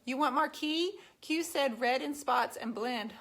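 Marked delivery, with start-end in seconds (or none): none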